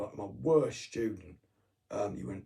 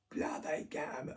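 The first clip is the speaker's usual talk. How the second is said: higher-pitched mock Cockney; Cockney quote; 7 semitones higher than usual. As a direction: imitate a Cockney woman's outburst, pitched up. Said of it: "Cockney slang, Cockney, Cockney tongue".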